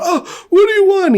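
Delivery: very high imitation of a victim; mock anguished cry. Shot dad pleading with his killer, mock anguish. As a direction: cry out like a shot man, hammed-up.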